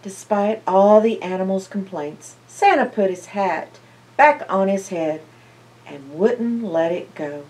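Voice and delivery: ordinary delivery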